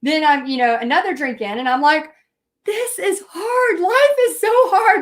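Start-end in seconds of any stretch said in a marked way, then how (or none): 2.6-5.0 high, slow, louder self-pitying wail; milk the self-pity, hammed-up; poor me whine. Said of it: "Poor me".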